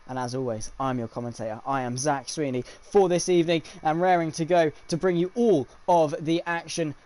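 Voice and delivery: loud, punchy race commentator delivery; motorsport broadcast intro. Acted. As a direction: announce the race night with punched key words.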